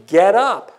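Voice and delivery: loud, firm command from the Lord; commanding call. Acted. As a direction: call it out like a divine order. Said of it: "It was a command".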